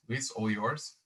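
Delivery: ordinary talk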